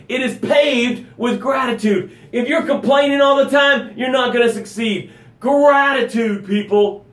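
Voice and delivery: ranting to push them on; yelling. Real, not acted. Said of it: "kind of yelling this morning".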